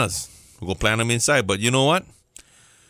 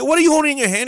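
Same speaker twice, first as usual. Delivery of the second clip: high, louder, exasperated question; exasperated challenge; 12 semitones higher, 3 dB louder, much faster than usual. Retold or imitated. Speaker challenging friend who wants an avocado, exasperated high. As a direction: demand he look at his own hand.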